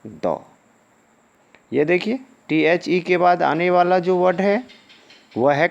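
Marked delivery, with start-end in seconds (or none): 0.1-0.4 द said short with a schwa, like "duh". Shown as a demonstration. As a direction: say "द" clipped, not "दी". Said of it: "इसका उच्चारण देंगे". ("द" छोटा, हल्का उच्चारण)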